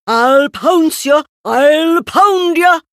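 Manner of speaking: high, slow, loud playful growl; boisterous tiger voice; bouncy Tigger. Acted. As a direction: pounce on them with a cheeky roar.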